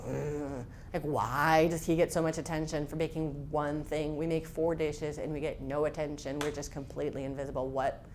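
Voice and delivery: low, soft, sulky complaint; miserable grumbling. Retold or imitated. Girl sulking to her mom over the cake, low and grumbling. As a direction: grumble it under your breath, hard done by. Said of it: "And I was just miserable".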